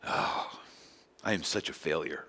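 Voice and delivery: whispered, dejected moan; mock despair. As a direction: sigh it out like a defeated failure. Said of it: "they're just kinda going like".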